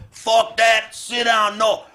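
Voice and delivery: high, loud barked order; mock mom yell. Mom yelling at her son to sit down, high and loud. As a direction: snap at the kid to sit down. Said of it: "Mom goes".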